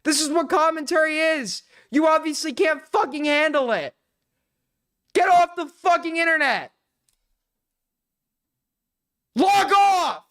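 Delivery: yelling insults at a streamer; furious yelling. Real, not acted. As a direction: shout her off the stream. Ranting at rival on stream.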